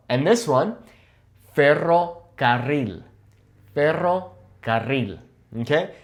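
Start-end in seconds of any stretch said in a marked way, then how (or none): 1.5-5.2 "ferrocarril" slow, in two halves, both double R's trilled; rolled R's. Shown as a demonstration. Hard practice word for viewers, slow trilled "ferrocarril". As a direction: draw out "ferrocarril" with long trills. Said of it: "learn how to roll your R's".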